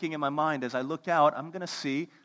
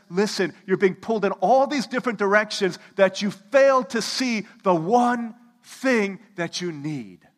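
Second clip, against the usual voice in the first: loud, high, pressing appeal; slow down hard on the last words; Jesus urging the crowd; urgent plea; 6 semitones higher, 9 dB louder than usual. Retold or imitated. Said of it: "And Jesus says".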